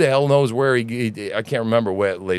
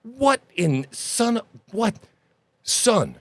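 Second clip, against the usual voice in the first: pretend angry father; 9 semitones higher than usual. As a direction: play the fed-up dad chewing out his son. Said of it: "Can you imagine that conversation?".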